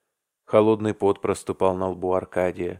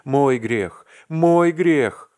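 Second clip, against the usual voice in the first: anguished cry; 7 semitones higher, 8 dB louder, much slower than usual. A narrator's reading. Vasya anguished confession.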